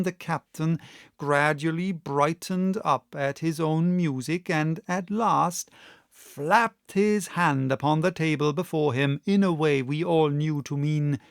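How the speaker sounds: usual speaking voice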